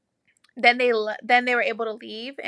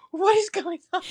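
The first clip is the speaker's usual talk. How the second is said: higher, alarmed mock panic; terrorized husband; 8 semitones higher, 3 dB louder than usual. Husband walking in sees her, mock terrified and higher. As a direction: imitate a scared husband's panicked question. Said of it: "his face is just, like, terrorized".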